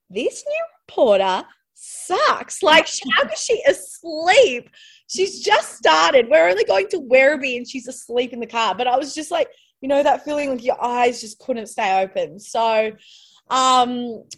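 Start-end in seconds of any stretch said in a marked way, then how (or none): 0.1-8.7 mocking cameraman. Cameraman mocking the sleepy new reporter, scornful. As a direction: sneer it like an unimpressed colleague. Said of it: "the cameraman was probably like".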